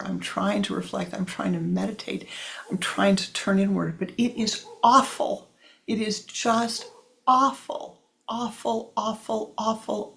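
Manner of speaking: high-pitched whiny young soul, acted; miserable complaint. Crying child tells yogi meditating is awful, miserable. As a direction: wail it high, hammering the repeated word awful.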